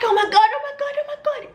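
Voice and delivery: high, frantic squeal; high mock panic; panicked. Re-enacting panic at intrusion.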